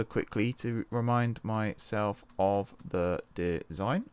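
slow, each syllable split apart; one syllable at a time. Real, not acted. Checking his model railway design, one syllable at a time. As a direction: spell it out syllable by syllable. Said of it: "saying one syllable at a time like that".

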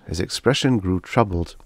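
plain reading voice

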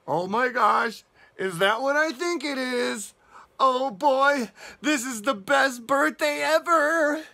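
high, loud, thrilled cow; excited. Acted. Storyteller voicing Cow seeing the birthday cake, thrilled. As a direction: gush with mounting birthday excitement. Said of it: "He's excited about that cake".

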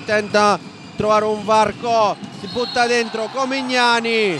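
normal talking voice

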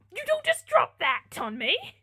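high, loud, slow indignation; hammed-up exasperation; mock outrage. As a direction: scold the writer in playful protest.

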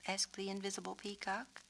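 ordinary delivery

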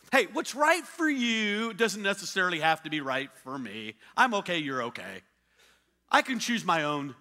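mock the know-it-all who ignores warnings; mock defiant rebel. Preacher parodying a defiant person, sarcastic. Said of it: "do you go".

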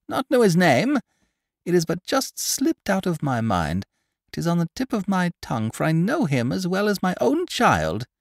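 chattering market woman spreading news; gossipy old woman. Acted. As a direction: relish every grisly detail like a village gossip. Pundor claims to know him.

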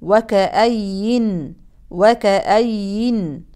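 وكأين slow, ending on a silent nun; pausal form. Shown as a demonstration. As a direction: stop on وكأين with sukun. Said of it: "أقف بالسكون". (وقف بالسكون)

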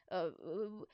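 hesitant, stumbling excuse; halting, uneasy boy's reply; nervous stammer. A narrator's reading. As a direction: trip over the first word, then drag it out. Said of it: "Harold stammered nervously".

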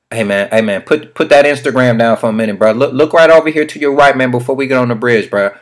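calling out. Pretend. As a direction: grab your buddy's attention across the car. Calling out to passenger in car.

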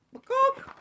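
a quick hen's squawk; chicken squawk. Acted. Chicken answering the song, squawking.

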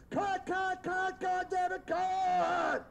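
high, loud repeated cut calls; retold angry yelling. Director shouting cut at the screaming actress, loud and high. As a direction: yell it like a director losing patience. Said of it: "He said".

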